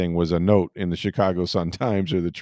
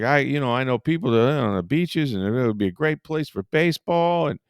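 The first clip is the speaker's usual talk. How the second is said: lightly raised pitch, eager ballplayer; light imitation of an eager manager; Ozzie Guillen; 6 semitones higher than usual. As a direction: sell the town happily, mildly hammed. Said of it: "he was just like".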